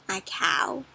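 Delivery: soft unvoiced whisper. Reader whispering the next animal to the children. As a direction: share the secret in a hush.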